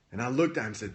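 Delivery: ordinary talk, nothing marked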